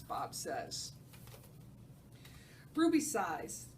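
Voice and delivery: ordinary delivery, nothing marked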